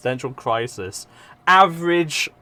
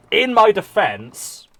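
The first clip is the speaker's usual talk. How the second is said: mock defense; 8 semitones higher, 6 dB louder, slower than usual.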